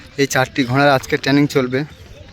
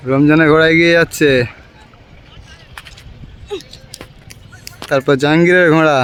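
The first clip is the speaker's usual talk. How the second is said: race caller; 3 semitones higher than usual. Real, not acted. Live horse race commentary.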